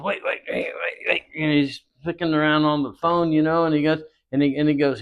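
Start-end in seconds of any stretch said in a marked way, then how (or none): 0.0-1.2 higher, breathy rush of waits; a hushed, hurried hold-on; urgent mock whisper. Boss stops the kid, imitated as an urgent high whisper.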